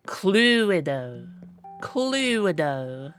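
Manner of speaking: Cluedo said as kloo-ih-doh with a long U. Shown as a demonstration. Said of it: "but long U".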